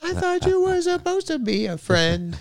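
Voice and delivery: high-pitched mock wail; mock hurt. Reacting to a friend who lies all the time, mock hurt. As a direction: play the betrayed friend in a high voice.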